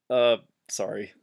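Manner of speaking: ordinary talk, nothing marked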